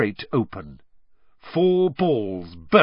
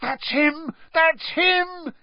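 excited yell; much slower than usual. A narrator's reading. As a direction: point him out in thrill. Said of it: "yelled Ron".

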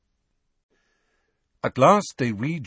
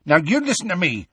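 quick, higher, angry interruption; 9 semitones higher, faster than usual. A narrator's reading. Hagrid interrupting Bane's warning, angry. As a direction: cut in angrily and take charge. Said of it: "said Hagrid angrily".

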